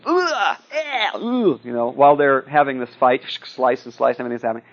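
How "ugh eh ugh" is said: high-pitched groans of disgust; mock "ugh" of revulsion; disgusted reader. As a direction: groan as if grossed out by the story. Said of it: "you're reading this and you're going".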